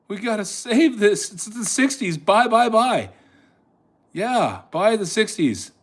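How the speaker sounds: high, pressing buy buy buy; urgent. Real, not acted. Urging buyers in.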